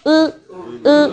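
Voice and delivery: "Ư" said with a rounded mouth; rounded Japanese Ư. Shown as a demonstration. Teacher drilling the class on the Japanese vowel, "Ư" with rounded lips. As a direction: lips in a U shape, sounding "Ư". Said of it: "tròn mồm".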